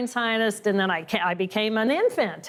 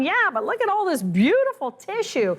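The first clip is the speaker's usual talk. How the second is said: enthusiastic reply; 8 semitones higher than usual. Retold or imitated. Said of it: "And I'm going".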